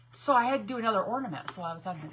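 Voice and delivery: ordinary talk, nothing marked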